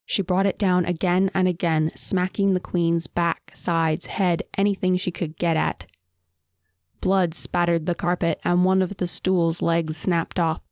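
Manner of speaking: ordinary delivery, nothing marked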